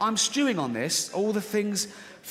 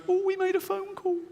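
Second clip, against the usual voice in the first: very high, mocking squeak; irrational inner voice. Irrational side alarmed by his phone call, squeaky. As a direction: squeal it as the suspicious side of the mind. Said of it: "This one goes".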